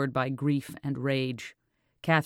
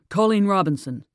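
hurried; 5 semitones higher, 6 dB louder than usual. A narrator's reading. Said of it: "he said quickly".

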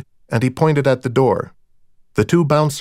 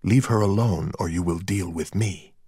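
softer, restrained menace; low-key, controlled threat; veiled threat; 3 semitones lower, 5 dB quieter than usual. A narrator's reading. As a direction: warn off a rival predator quietly. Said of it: "there was a message in it".

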